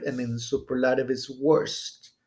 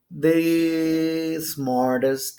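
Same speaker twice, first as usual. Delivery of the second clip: slow modelling; much slower than usual. Shown as a demonstration. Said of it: "teacher models the word".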